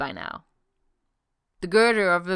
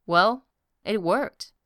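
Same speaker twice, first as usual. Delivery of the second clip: smug; 3 semitones higher, slower than usual. A narrator's reading. Loki's smug answer.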